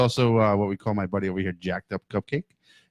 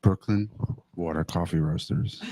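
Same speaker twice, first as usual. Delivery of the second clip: sexy voice; 4 semitones lower, 6 dB quieter than usual. Acted. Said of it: "Uh-oh, sexy voice".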